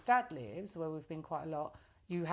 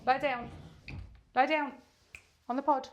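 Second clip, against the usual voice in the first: dog command; 5 semitones higher than usual. Real, not acted. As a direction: order the dog to lie down.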